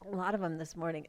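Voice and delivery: ordinary talk, nothing marked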